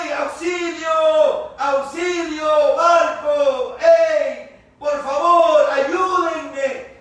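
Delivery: high, loud drawn-out shout; desperate cry for help. Acted. Castaway hailing a distant ship, desperate drawn-out shouts. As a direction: yell to a distant ship to save you. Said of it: "uno se desespera".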